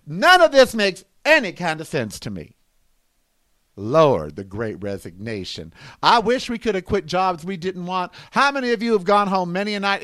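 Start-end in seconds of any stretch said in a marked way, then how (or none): 3.7-5.7 tired, despairing mutter; weary groan. Real, not acted. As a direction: drop it down like you're fed up. Exasperated refrain of the rant.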